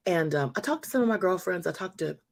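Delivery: usual speaking voice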